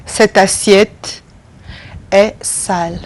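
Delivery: "Cette assiette est sale" said slowly, word by word; careful classroom French, slow; slow French drill. Shown as a demonstration. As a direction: spell it out slowly for learners. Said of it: "pronunciation drill".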